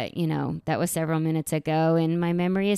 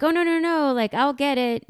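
tag; 7 semitones higher than usual. Retold or imitated. Acted Ross hurriedly stopping Doctor Green.

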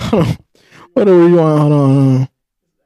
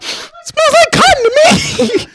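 high mock voice; slower than usual. Acting out picking up AirPod.

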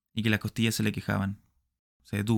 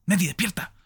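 higher, rising urgent call; 9 semitones higher, 3 dB louder than usual. Acted. Smith urges Nettie to wake, high urgent call. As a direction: call her awake.

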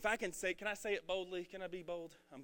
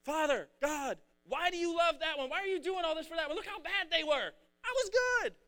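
high, pleading, indignant complaint; upset whining; 10 semitones higher, 5 dB louder than usual. Retold or imitated. Older son whining to the Father, upset. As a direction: whine it like the jealous older son. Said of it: "He gets upset".

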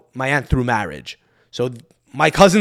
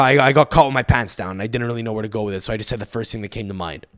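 normal talking voice

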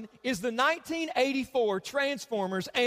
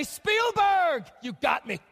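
higher and louder, a playful cry of defeat; mock shout at Spielberg; 5 semitones higher, 3 dB louder, slower than usual. Pastor tells the church what he yells at Spielberg, playful mock shout. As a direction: call out to Spielberg like he beat you. Retells what he says watching E.T.

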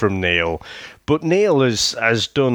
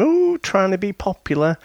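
mocking sneer; 9 semitones higher than usual.

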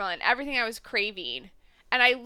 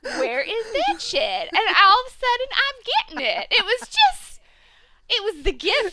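gleeful squeal; 9 semitones higher, 5 dB louder than usual. Real, not acted. Excited about the returning newbies.

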